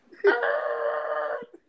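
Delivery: long squealing brake noise; imitated screeching halt. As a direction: screech like braking tires. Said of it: "it was, like, to a screeching halt".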